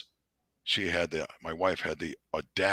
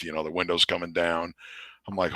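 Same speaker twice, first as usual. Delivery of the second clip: usual speaking voice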